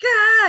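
sudden high loud yell of frustration; demo scream. Shown as a demonstration. Showing how she wishes she could scream out loud. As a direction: let out a quick high scream. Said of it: "I wish I could scream".

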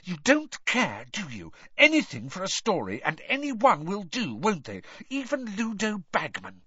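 high, indignant accusation; through gritted teeth. A narrator's reading. Hermione accuses the reporter, through gritted teeth. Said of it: "she said through gritted teeth".

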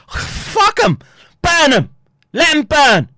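high, loud outburst; angry shout. Real, not acted. Streamer condemning those avoiding the issue, angry shout. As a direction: spit out each short curse at full voice. Furious verdict on his opponents.

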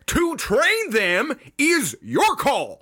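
high, slow, drawn-out coach holler; Little League coach. Acted. Coach charging players to train Pokemon, high slow holler. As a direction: stretch it out like a hammed-up coach. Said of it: "like a Little League coach".

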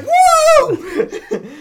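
high, loud imitated squad cheer; long, high-pitched celebratory woo; cheering whoop. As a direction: whoop like thrilled teammates. Teammates cheer his return.

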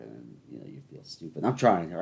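ordinary delivery

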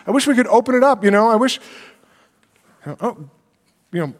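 ordinary talk

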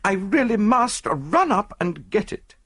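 high, gushing wife showing off; excited society lady. Acted. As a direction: enthuse breathlessly about your treasure, pitched high.

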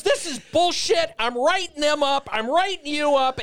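imitating the boss laying down the law; mock angry boss. General manager threatening write-ups, high mock anger. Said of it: "He goes".

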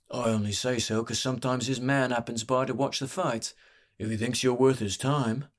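lightly put-on barkeep voice; canny barkeep, hinting. Barkeep telling a fighter about pit fights, hinting. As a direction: let the offer slip out casually.

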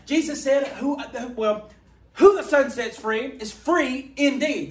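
fiery revival preaching; preaching at full force. Acted. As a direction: preach it out with rising fervor.

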